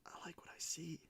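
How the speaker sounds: soft whispered delight. Real, not acted. Whispering delight at a good card to viewers. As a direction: breathe it out in admiration.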